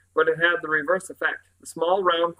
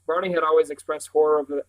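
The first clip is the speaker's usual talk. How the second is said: usual speaking voice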